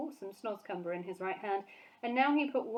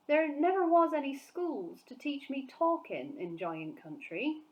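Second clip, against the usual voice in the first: sad; 6 semitones higher, 3 dB louder than usual. A narrator's reading. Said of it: "the B F G said sadly".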